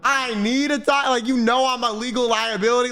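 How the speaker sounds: high, slow mock outrage at him; incredulous pushback. Streamer retorting to the lawyer, mock indignant. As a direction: drag it out in disbelief. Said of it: "I was like".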